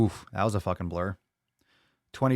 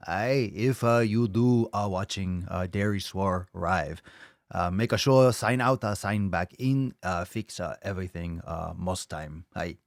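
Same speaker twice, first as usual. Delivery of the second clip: lightly put-on broken-English sensei voice, slow; half-hearted mock mentor accent; mock Miyagi; 3 semitones lower than usual. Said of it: "Miyagi say".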